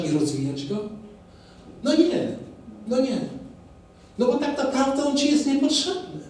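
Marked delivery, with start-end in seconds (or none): 1.8-6.2 mock dismissive. Ironic voice for doubters' view.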